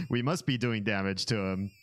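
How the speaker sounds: ordinary delivery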